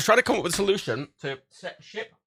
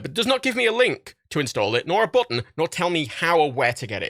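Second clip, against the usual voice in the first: normal talking voice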